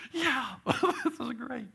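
high, slow giggly delight; kids giggling. Retold or imitated. Players giggling over the coach's sneaky plan, high and slow.

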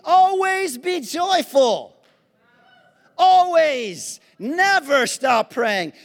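loud, high preaching voice with every line punched; preacher lifting praise to God at full force. Acted. Preacher praising God before the congregation, loud and high.

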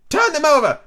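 high, loud command; angry Queen. A narrator's reading. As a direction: order the Knave as an angry queen. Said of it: "The Queen turned angrily away".

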